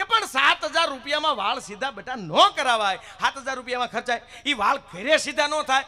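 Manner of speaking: scolding mother-in-law. Retold or imitated. Mother-in-law scolds her daughter-in-law over money, indignant. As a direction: scold the bride in disbelief.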